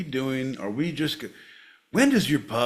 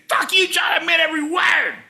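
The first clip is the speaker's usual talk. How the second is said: high, loud mock tirade; yelling; 9 dB louder than usual. Shown as a demonstration. Sample lesson yelling at John, high angry shout. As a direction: double down with a hammed-up shout. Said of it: "Yelling at John again".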